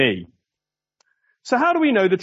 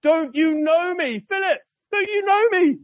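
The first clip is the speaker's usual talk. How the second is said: exasperated Jesus; 12 semitones higher than usual. Acted. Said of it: "I can sense the exasperation in his voice".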